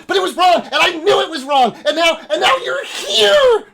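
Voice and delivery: very high, loud frantic shout; crazed prophet's outburst; insane elf. Acted. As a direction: build to an ecstatic mad shriek. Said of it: "fucking insane elf".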